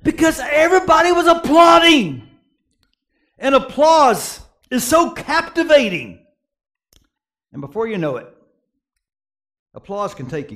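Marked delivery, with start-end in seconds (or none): 0.0-6.2 high and loud, laughing through it; fervent preaching. Acted. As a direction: sweep them up in the applause. Preaching climax about applause.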